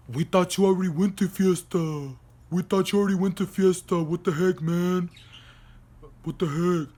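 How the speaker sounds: put-on dopey complaining voice; mock-indignant imitation of friends. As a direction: mimic clueless guys protesting. Mocking her friends' retold objection.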